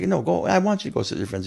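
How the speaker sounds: retold insistence, starting high; not being mean. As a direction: urge him on like an encouraging dad. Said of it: "he's not even being mean about it".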